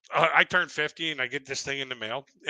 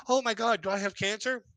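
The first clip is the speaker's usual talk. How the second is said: higher, hammed-up oh my God; mock panic; 6 semitones higher than usual. Patient answering the doctor's phone call, mock panic. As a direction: act the patient's sudden fear, tongue in cheek.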